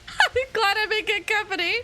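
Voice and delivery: mock crying.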